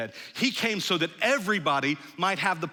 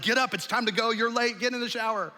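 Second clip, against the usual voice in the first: higher, rushed wake-up nag; hurried nagging parent; 7 semitones higher, 3 dB louder than usual. Retold or imitated. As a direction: hurry the kids out of bed. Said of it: "different than the way we wake up".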